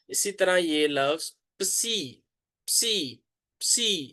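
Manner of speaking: psi with both p and s heard, slow; pron psi. Shown as a demonstration. Teacher demonstrating the psi sound, slow and clear. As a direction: say psi with p and s together. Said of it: "इस तरह इसकी pronunciation है".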